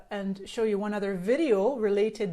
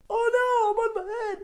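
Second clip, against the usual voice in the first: mock alarm; 13 semitones higher, 6 dB louder than usual. Camera flips, mock alarm.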